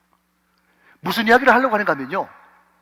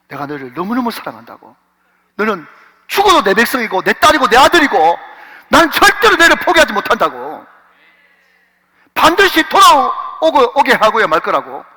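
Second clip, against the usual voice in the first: God crying out; 3 semitones higher, 6 dB louder than usual. Acted. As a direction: start tender, then cry out the promise. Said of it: "하나님 외치고 있어요". (부드럽게 시작해 크게 외치듯)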